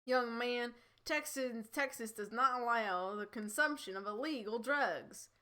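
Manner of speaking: stern Texan policeman; Texan accent. Acted. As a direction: lay down the law in a Texan drawl. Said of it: "thick Texan accent".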